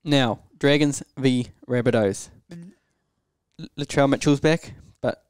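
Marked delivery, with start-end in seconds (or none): none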